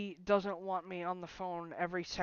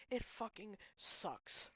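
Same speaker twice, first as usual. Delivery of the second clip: defeated whisper; 9 dB quieter, slower than usual. Real, not acted. Breakup vent, sighing.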